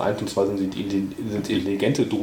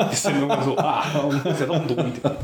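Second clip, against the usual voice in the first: laughing; 4 semitones higher than usual. Real, not acted. Laughing at own mix-up.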